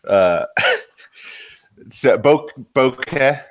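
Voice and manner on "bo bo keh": "bokeh" with a short open "eh" ending, BOH-keh; pronunciation demo. Shown as a demonstration. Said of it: "it's been pronounced seven different ways".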